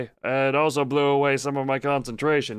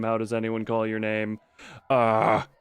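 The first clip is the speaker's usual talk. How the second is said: normal talking voice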